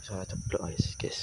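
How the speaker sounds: hushed, breathy whisper to viewers. Real, not acted. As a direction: whisper so nothing hears you. (bisik-bisik)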